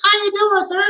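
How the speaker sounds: friendly greeting. Retold or imitated. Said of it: "Hi, Mister".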